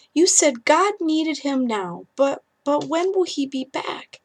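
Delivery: put-on high, timid small boy; sleepy little boy calling for mommy. Little boy Ethan in story.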